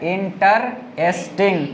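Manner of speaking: Interesting slowly as इन-टर-एस-टिंग; a teacher's slow four-part interesting; syllable by syllable. Shown as a demonstration. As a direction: separate the beats of interesting. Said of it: "कुल मिला के चार गो हो जा रहा है".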